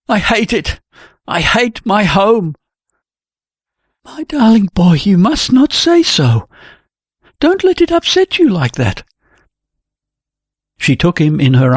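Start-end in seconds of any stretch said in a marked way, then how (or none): 0.1-2.6 raised, slowing outburst; a touch of bitter despair, pitched up; mild cry of hatred. Acted. Helg bursts out to Jenny in mild bitter anger. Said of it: "clenched his hands and cried". 4.0-9.0 tender comfort. Acted. Jenny comforts weeping Helg.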